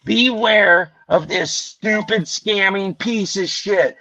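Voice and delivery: slow, drawn-out hillbilly reading; deliberate mock-innocent hillbilly drawl. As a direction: read the screen aloud like a hillbilly. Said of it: "I played the regular American hillbilly".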